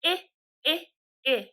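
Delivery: three separate short-i "eh"s, as in "it"; short I sound. Shown as a demonstration. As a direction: pop out "eh, eh, eh" with gaps between. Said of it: "you have to make the I sound".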